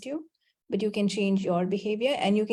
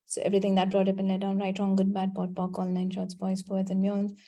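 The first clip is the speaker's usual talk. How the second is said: rote clearing statement; 2 semitones lower than usual. Acted. Clearing statement recited again.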